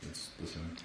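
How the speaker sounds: very soft, subdued answer; low voice. Real, not acted. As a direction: answer barely above a murmur. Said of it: "in this low voice".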